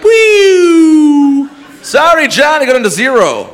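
high, loud chirpy game show host; mock host voice, bright and loud; cheery host. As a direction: announce the loss with fake cheer.

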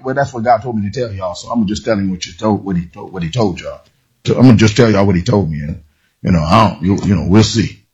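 low, fast, mumbling go-between voice; half-hearted messenger. Retold or imitated. Preacher mocks a reluctant messenger, low and mumbled. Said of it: "he wasn't saying it like".

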